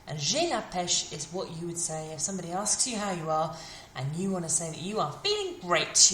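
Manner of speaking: normal talking voice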